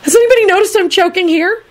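very high, panicked appeal; rising frantic alarm. A narrator's reading. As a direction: plead for someone to notice. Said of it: "Neville thought frantically".

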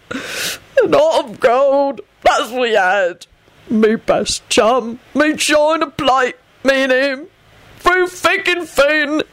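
slow, high, broken wail; guttural sobbing. A narrator's reading. Bald giant mourns his best chum, slow sobbing. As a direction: drag each phrase out through acted sobs. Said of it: "broke into great guttural sobs".

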